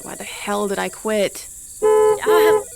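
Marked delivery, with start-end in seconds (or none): 1.8-2.6 sudden high, loud shriek of fright; startled scream. Real, not acted. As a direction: jump out of your skin with a scream. Startled by mother.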